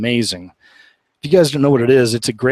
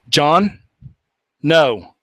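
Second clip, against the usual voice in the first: a firm, louder stern warning; retold stern rebuke to a rude companion; 4 semitones higher, 4 dB louder than usual. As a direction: lay down the law with his name. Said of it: "I said his name really stern".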